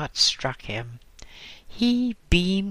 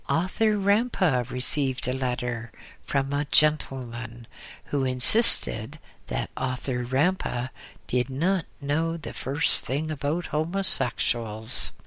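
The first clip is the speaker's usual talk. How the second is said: usual speaking voice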